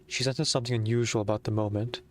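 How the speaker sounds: put-on light British accent on the story narration; British narrator accent. Streamer reads the story narration in a British accent. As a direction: read it like a British storyteller, measured. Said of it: "reading the narration in a British accent".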